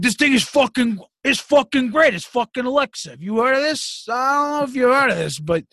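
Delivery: put-on high, excited old man gushing; a high-pitched old father amazed by Alexa; old dad voice. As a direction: imitate your dad raving about a gadget, high and fast at times. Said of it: "And he's like".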